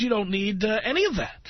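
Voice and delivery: normal talking voice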